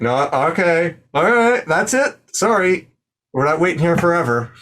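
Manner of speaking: high, hurried host cutting in; impatient host. Acted. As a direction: cut the contestant off. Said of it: "Hoppy's like".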